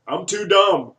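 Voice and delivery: high, loud blurted confession; hammed-up loud outburst; mock shout. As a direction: call it out like a heckler, for laughs. Retold outburst from the audience.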